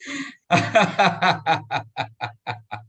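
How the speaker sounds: hearty demo laugh; laughter yoga demo laugh; appreciation laugh. Shown as a demonstration. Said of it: "appreciation laughter, it is called".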